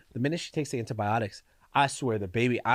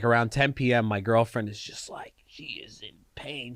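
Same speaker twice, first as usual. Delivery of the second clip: ordinary delivery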